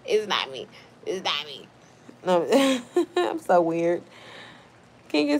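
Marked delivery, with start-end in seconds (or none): 0.1-1.7 low joking voice. Shown as a demonstration. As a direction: drop into a deep mock voice. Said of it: "My liquor is making me be like this".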